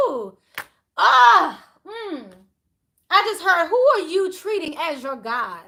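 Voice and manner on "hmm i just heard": normal talking voice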